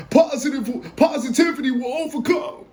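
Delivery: high, squeaky mock-inspirational voice; high-pitched goofy proclamation; put-on changed voice. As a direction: pitch it way up, playfully. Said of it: "I'm changing my voice".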